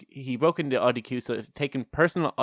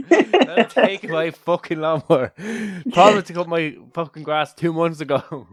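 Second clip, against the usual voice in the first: mock rant; 5 semitones higher, 3 dB louder than usual. Voicing angry neighbour.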